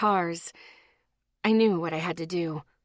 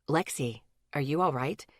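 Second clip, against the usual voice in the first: softly asked, lower male voice; 6 semitones lower, 5 dB quieter than usual. A narrator's reading. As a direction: ask it gently and softly. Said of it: "he asked softly".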